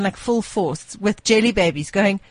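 ordinary delivery

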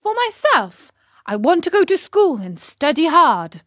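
high, loud, keen declaration; emphatic eagerness. Acted. Pinocchio answering the fox about school, eagerly. Pinocchio insists on his plan.

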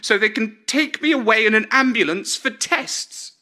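put-on an outraged elderly mother threatening drama; Grandma's voice. A narrator's reading. Grandma texting her son, fed up and high-pitched.

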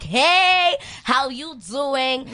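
high, loud, drawn-out hello; friendly greeting. Retold or imitated. Smiler going up to talk to new people, gushingly friendly. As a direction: sing out a super-friendly greeting, stretched slow. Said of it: "I love smiling at people".

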